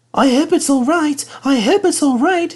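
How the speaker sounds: high, worried coach voices, voiced not whispered; anxious coaches. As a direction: fret together like two nervous coaches. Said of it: "they whispered anxiously".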